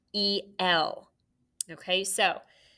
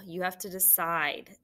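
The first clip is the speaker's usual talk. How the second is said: ordinary talk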